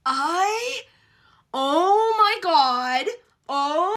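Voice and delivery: slow high drawn-out moan; overwhelmed swoon. Real, not acted. Fan swooning at cocky hero, drawn-out. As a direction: melt over it.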